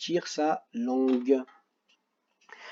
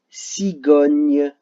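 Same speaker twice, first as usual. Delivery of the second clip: cigogne drawn out slowly, louder; slow syllable demo; 8 dB louder than usual. Shown as a demonstration. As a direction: stretch cigogne so the sound can be heard. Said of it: "là où vous entendez le son G". (cigogne très lente et détachée)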